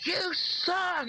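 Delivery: high, mocking crowd yell; imitated heckler's yell. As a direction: mimic an obnoxious fan yelling from the stands. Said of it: "that idiot was that yelled".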